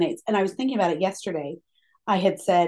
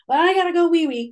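grandpa's announcement; 8 semitones higher, 4 dB louder than usual. Retold or imitated. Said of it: "It was always wee wee".

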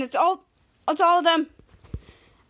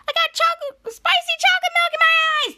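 very high, squealing character voice; panicked cartoon wail; 13 semitones higher, 4 dB louder than usual. Tiny character with spicy milk in eyes, mock wailing.

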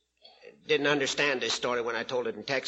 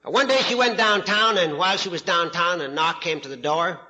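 ordinary delivery

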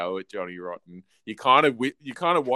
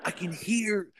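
whispered and higher, mimicking a friend; mock whisper; 10 semitones higher, 6 dB quieter than usual. Retelling a friend's hushed remark in a whisper. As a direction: breathe it out as a hushed aside. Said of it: "a friend ... was like".

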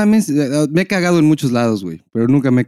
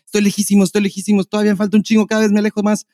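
higher, insistent self-talk; mock inner voice; 7 semitones higher than usual. Guy tricking his bladder while driving, higher insistent inner voice. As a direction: convince yourself you're far away. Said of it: "Entonces dices".

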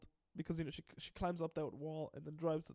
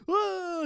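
mock yell; 13 semitones higher, 11 dB louder than usual. Jazz thrown out, mock cry.

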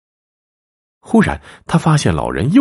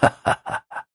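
soft breathless laugh; a sly, creepy little laugh; old man chuckling mysteriously; eerie whispered chuckle; 4 dB quieter than usual. Acted. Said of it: "诡异的笑容".

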